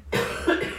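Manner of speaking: harsh choking cough; a real coughing fit. Real, not acted. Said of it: "je m'étouffe en direct".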